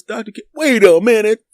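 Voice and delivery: high, loud mock outrage breaking off mid-name; sudden realization. Friend catching the doctor-name prank, loud high mock protest.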